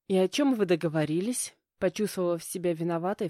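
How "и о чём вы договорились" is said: usual speaking voice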